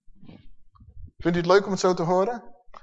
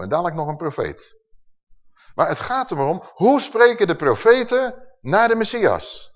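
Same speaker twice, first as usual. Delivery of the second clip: normal talking voice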